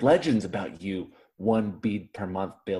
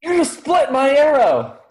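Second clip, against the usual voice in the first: outraged; 8 dB louder, slower than usual. Acted. Arrow split, shrill outrage.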